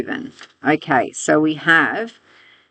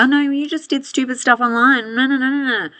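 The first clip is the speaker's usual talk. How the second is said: mocking, much higher put-on voice; 9 semitones higher than usual. Teacher parodying tech companies dodging blame, high mocking voice. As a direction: sneer at their feeble excuse in a high mock tone. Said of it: "he's sort of mocking them".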